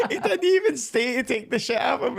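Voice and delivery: high-pitched, laughing retelling; real amusement breaking into the words. Real, not acted. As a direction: laugh your way through the sentence. Laughing while telling the heckler story.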